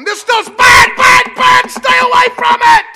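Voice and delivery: high, loud shouted warning; fiery preacher yelling a warning; preacher's warning shout. Pretend. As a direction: hammer "bad, bad" to the flock. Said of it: "a preacher saying".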